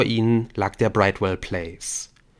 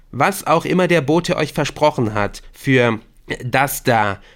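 higher, louder, emphatic warning; insistent, raised-voice appeal; urgent Victor; 5 semitones higher, 5 dB louder than usual. Acted. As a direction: swear to them they are being duped. Victor warns the captors.

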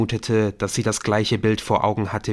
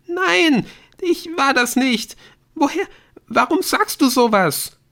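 very high, loud panicky denial; frightened girl blurting in bursts; panicked; 5 dB louder than usual. A narrator's reading. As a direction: protest in rising panic, voice way up. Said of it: "Die Panik in ihrer Stimme".

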